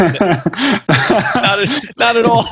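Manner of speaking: laughing through the words; giggling out a denial. Real, not acted. Host laughs at self-deprecating joke.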